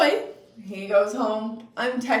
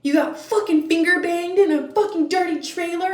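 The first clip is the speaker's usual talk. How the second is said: louder, higher mock yell of the boyfriend; raised, outraged accusation; mock angry yell; 7 semitones higher, 5 dB louder than usual. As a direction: bark it like the furious boyfriend. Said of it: "boyfriend's shout".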